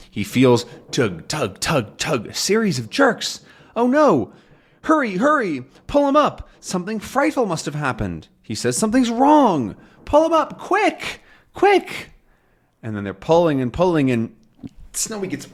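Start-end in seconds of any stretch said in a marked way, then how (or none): 3.8-8.3 high, urgent panicked calls; alarmed. Acted. Tintin seeing the danger signal, high frantic alarm. 8.8-12.1 very high, loud urgent shouts; frantic shout. Acted. Tintin urging the crew to pull Haddock up, high frantic shouting.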